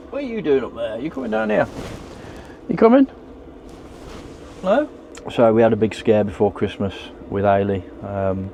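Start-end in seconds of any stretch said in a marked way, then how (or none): 0.1-4.9 shouting up scaffolding. Real, not acted. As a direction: call up to the dog above. Calling up to dog.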